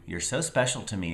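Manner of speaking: ordinary delivery, nothing marked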